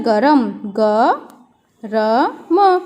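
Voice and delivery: "गरम" then slowly "ग", "र", "म" with pauses; spelled out. Shown as a demonstration. Teacher spelling "गरम" slowly for pupils. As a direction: say the word, then spell it sound by sound. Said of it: "तो soup कैसा होता है?".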